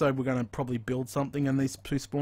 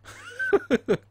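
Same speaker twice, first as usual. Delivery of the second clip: high-pitched relieved giggle; 3 dB louder than usual. Real, not acted. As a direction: let out a squeaky laugh of relief. Laughs after surviving.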